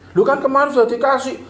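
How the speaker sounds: hammed-up quarrel voice; mock retort. Husband retorts in acted quarrel.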